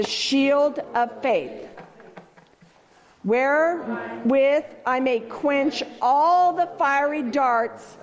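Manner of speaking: slow, measured proclamation of faith; solemn scripture declaration. Acted. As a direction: declare each phrase slowly like a vow. Reciting armor of God declaration.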